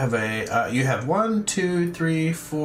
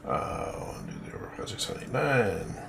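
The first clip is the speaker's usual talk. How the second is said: whispering; 6 semitones lower, 8 dB quieter than usual. Real, not acted. Counting chips under breath.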